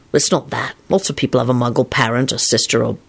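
low, faintly gloomy reply. A narrator's reading. Lupin correcting Elizabeth about Muggle clothes, low gloom. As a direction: explain it low and downcast. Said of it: "he added gloomily".

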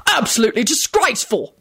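stern medic's indignation; barked. A narrator's reading. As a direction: bark it in disgust. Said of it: "barked the senior medic".

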